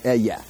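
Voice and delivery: Ehyeh said as EH-yeh, stress on the first syllable; careful teaching pronunciation of Ehyeh; Hebrew pronunciation. Shown as a demonstration. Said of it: "Ehyeh is how I would say it".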